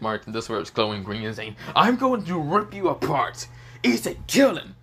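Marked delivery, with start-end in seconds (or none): 1.7-4.8 acted roar of outrage, stretching the words; enraged threat. Insulted dragon orders Issei to attack, in loud high rage.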